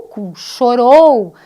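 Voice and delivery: teacher matching the rhyme, very slow and loud; very slow example. Shown as a demonstration. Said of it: "a rima viria no ou".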